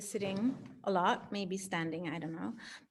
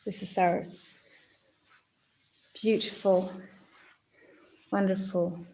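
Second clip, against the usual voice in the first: guided meditation. Acted. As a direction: gently guide them into their body.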